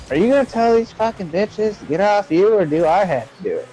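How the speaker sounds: high-pitched mock girlfriend voice; imitating indignant girlfriend. Girlfriend confronting boyfriend about other women, high mock indignation. Jen's jealous demand, acted out.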